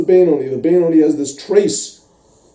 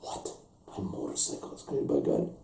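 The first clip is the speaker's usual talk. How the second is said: stunned, whispered disbelief; breathless hushed wonder; amazed whisper; 9 semitones lower, 19 dB quieter than usual. Acted. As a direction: whisper it in awe. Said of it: "and you're like".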